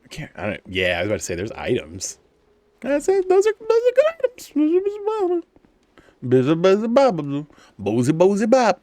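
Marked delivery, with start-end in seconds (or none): 2.8-8.8 loud, very high goofy gibberish; silly babble voice. Pretend. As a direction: squeal it out as playful nonsense. Silly nonsense babble about items.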